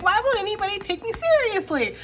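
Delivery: put-on high, whining complaint like a stomping child; little gray munchkin stamping his feet, high whiny protest. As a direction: whine it like a little one throwing a tantrum. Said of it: "stamping his feet and be like".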